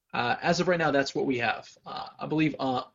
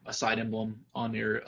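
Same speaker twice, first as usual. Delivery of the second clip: usual speaking voice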